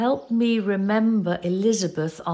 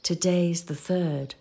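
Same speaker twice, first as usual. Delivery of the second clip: usual speaking voice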